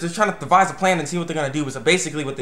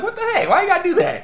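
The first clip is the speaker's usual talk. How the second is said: much higher, squealing protest with a laugh; mad. Retold or imitated. Himiko protesting to Taichi, high squealing complaint. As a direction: squeal it, outraged. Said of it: "she was mad".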